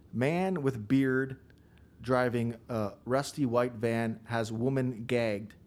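slow, deliberate reading out; reading a complaint. Retold or imitated. Cop reading complaint from his screen, slow deliberate read. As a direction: read each word slowly like a report. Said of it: "He goes".

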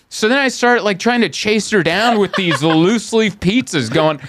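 ordinary talk, nothing marked

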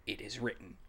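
soft, low, falling "It is written."; hushed reverent delivery; solemn quote. Shown as a demonstration. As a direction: let "It is written." fall hushed and final. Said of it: "That's my line".

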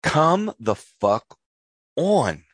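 slow drawn-out Midwestern exasperation; Midwestern accent. Pretend. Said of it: "I have to do my Midwestern accent".

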